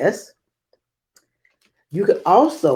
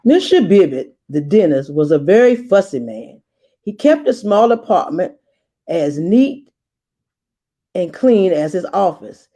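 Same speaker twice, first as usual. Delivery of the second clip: story narration; 3 semitones higher than usual. A narrator's reading. Guest reader opening the book for young listeners, storytelling. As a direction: read it like a picture book for kids. Starts reading the story aloud.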